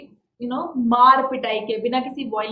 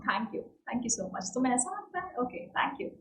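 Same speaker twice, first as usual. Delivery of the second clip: soft humble thanks; 11 dB quieter than usual. Shown as a demonstration. Teacher models quiet humble thanks for a compliment. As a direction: murmur the thanks gently, almost whispered at the end. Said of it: "मैं उस case में क्या बोल सकता हूँ".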